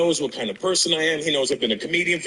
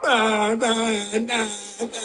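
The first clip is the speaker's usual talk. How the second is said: excited yelps; 7 semitones higher, much slower than usual. Retold or imitated. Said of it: "as soon as I walk up".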